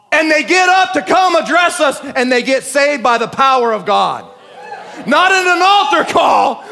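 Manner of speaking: loud, high, shouted preaching; preaching at full force. Acted. Preacher shouting revival to the congregation, full force. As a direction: bring the fire of the pulpit.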